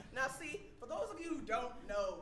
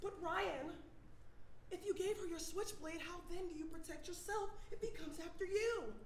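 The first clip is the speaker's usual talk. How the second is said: lightly voiced listener, higher pitch; mock-concerned questioner, pitched up; imagined audience question; 6 semitones higher than usual. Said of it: "I know what you guys are thinking".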